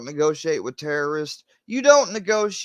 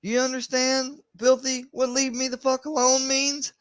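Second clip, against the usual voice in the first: high-pitched, real exasperated pleading; exasperated demand; 13 semitones higher than usual. Real, not acted. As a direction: demand it at the end of your tether, voice way up high. Exasperated demand to be left alone.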